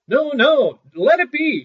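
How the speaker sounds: very high, loud panicked cry; terrified shout. A narrator's reading. Sexton in the chest, shouting in panic to Little Claus. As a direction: scream for your life from inside the box. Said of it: "shouted the sexton".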